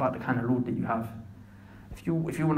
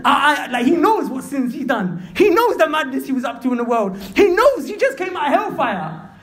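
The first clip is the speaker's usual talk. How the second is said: loud, high-pitched sermon delivery; ringing, heated exclamations; impassioned preaching; 12 semitones higher, 6 dB louder than usual. Real, not acted. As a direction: preach at full force, amazed. Preaches at full force.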